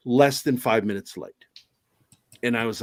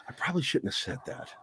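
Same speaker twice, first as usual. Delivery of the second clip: sheepish mumble; 15 dB quieter, faster than usual. Retold or imitated. Retold sheepish reply, fast and quiet.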